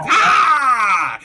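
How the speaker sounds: long, high, loud "haaa"; drawn-out attack cry falling at the end; charging goblin yell. Acted. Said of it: "they run in and they're like".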